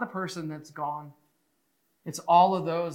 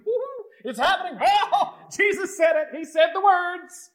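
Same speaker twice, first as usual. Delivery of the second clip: high, loud whooping excitement with laughter; lit up; 13 semitones higher, 5 dB louder than usual. Acted. Mary and Martha thrilled as Jesus speaks, lit up. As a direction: cheer in giddy, squealing anticipation. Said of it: "They were probably lit up".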